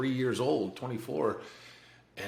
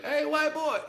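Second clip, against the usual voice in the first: calling across the hall. Retold or imitated. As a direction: call out to a neighbor through the cell door, pitched high. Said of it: "starts calling over to me every day".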